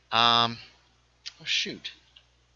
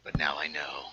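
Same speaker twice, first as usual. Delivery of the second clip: whispering; 11 semitones lower, 7 dB quieter than usual. Real, not acted. Aside after teasing friend.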